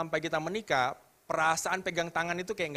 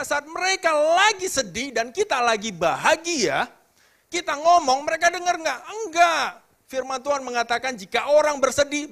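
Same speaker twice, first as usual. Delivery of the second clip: loud, high-pitched preaching at full force; impassioned preaching; 12 semitones higher, 8 dB louder than usual. Real, not acted. Preacher urging listeners to match others' feelings, impassioned. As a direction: preach it emphatically, voice raised high.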